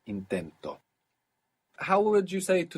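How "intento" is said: intento said low, with the accent on TEN; stress on penultimate syllable. Shown as a demonstration. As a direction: lean on TEN in intento, drop away on TO. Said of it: "is also moving the accent".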